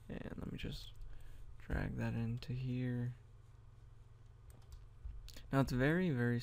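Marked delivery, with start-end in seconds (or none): 0.1-0.9 whispered aside to himself; breathy whisper while working. Real, not acted. Muttering to self while editing.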